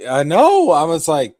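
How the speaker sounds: high, loud burst of fan excitement; loud, giddy, way up high; excited shout. Real, not acted. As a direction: yell it like you can't contain it. Hyped fan agreeing loudly.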